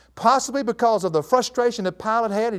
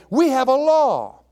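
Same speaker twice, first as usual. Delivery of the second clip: raised, loud, drawn-out declaration; insistent retort; 7 semitones higher, 6 dB louder, slower than usual. Acted. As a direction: insist on it, high and slow. Crowd's retort to Pilate, voiced.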